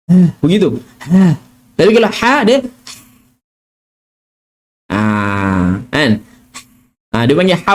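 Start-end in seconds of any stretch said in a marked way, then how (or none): none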